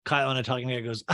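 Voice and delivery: usual speaking voice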